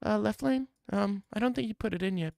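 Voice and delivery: put-on high, soft unimpressed girl voice; deadpan and high, not feeling it; bored girl. Girl unimpressed by Left Lane, high and soft. Girl's reply again.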